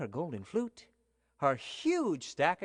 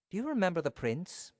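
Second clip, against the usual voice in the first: usual speaking voice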